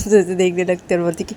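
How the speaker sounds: ordinary delivery, nothing marked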